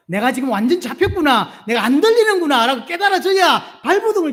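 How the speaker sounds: rising shout. Acted.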